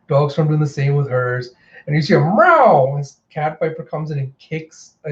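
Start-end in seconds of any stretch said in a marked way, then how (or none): 2.2-3.0 loud, high imitation meow; sudden mock feline battle cry; cat yowl. Imitates cat's attack cry.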